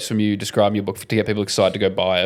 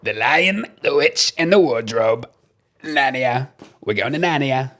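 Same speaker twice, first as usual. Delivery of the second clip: a hint of Trump reciting Narnia; lightly voiced Trump warm-up line; Trump lead-in line; 3 semitones higher, slower than usual. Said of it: "my lead-in line is saying".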